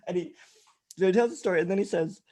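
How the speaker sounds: normal talking voice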